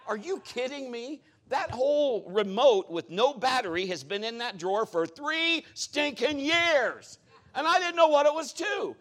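high, loud incredulous rant; exasperated outburst. Retold or imitated. Preacher retells discovering the forgotten remote, exasperated outburst. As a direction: build to a drawn-out, high shouted complaint.

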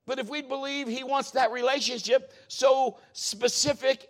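high, loud, insistent sermon delivery; full-force preaching. Real, not acted. Preacher urges congregation to believe God will talk, forceful preaching. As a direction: drive the point home loudly.

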